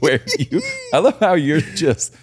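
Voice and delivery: high, laughing through the words. Real, not acted. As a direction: try to talk while cracking up. Amused by the host's sudden turn.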